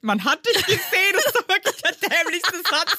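high-pitched, incredulous laughing; really amused, voice way up high; laughing mockery. Real, not acted. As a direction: repeat it while cracking up. Friend mocks the bluff, laughing.

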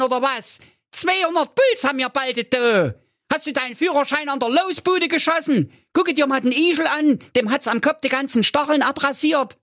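very high squeaky critter voices, cursing; high cartoon animals griping and mocking; grumpy hare and hedgehog. A narrator's reading. Hare and hedgehog scold the reckless driver, high squeaky voices. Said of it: "humpelnd unter dem Polizeiauto hervor und sprachen".

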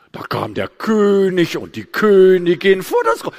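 loud, slow, overblown storytelling singsong; Grundschullehrerin. Retold or imitated. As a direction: parody a teacher telling fairy tales to small children. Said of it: "Wie reden Sie denn?". (übertrieben gedehnter, lauter Märchenton)

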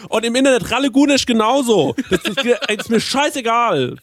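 high-pitched, mock-aggrieved complaining; loud indignant rant. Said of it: "Du bist zu laut". (lautes, empörtes Gejammer in hoher Stimme)

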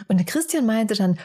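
ordinary delivery

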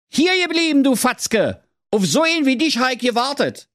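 very high, pushy little king; very high king voice calling a lad back; King Curry. Acted. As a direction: bark him to a halt like a squeaky king. King Curry speaks to Thorsten.

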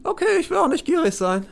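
high-voiced, conceding cellmate; appeased prisoner. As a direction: back down like a sheepish beggar.

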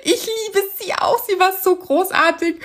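touched gushing. Real, not acted. Cohost gushing, moved, about the lovely official. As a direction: gush with emotion.

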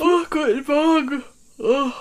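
talking through a yawn. Real, not acted. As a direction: yawn out the greeting. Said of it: "immer das Reden beim Gähnen".

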